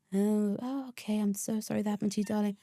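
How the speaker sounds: mock sympathy. Mimicking the second agent's fake sympathy, soft. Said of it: "The second one".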